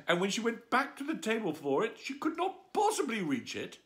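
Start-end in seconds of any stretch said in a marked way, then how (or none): none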